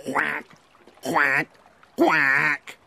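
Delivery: frog quacking like a duck; a mild duck quack. A narrator's reading. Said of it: "he went".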